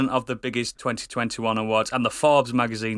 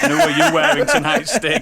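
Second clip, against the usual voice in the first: much higher, louder gushing host; gushing red carpet chatter; 7 dB louder than usual. Acted. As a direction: gush over the guest on the red carpet. Red carpet interviewer asks guest.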